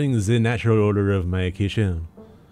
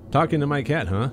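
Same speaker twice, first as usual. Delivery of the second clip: ordinary talk, nothing marked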